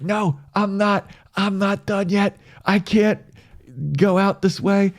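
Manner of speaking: high, determined anime hero voice; high defiant protest. Retold or imitated. Nishi defiant while fleeing.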